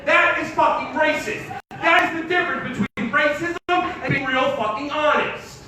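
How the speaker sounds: normal talking voice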